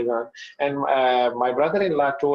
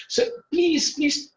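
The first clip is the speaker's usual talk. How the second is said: tearful pleading; 12 semitones higher, slower than usual. Retold or imitated. Voicing weeping woman's plea.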